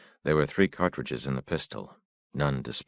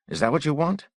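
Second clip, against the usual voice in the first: raised, angry challenge; harsh; 6 dB louder than usual. A narrator's reading. Father confronting his son about dying, raised and harsh. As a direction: throw the question at him.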